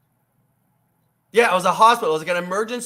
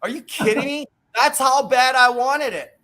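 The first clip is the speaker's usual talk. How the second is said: incredulous; 6 semitones higher, 4 dB louder than usual. Real, not acted. Scoffing at being called lucky.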